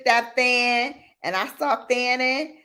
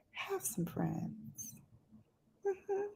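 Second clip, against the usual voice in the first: very soft, slow; softly drawn friends; trailing off; 9 semitones higher, 18 dB quieter, much slower than usual. Real, not acted. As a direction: let it fade out. Trailing repetition.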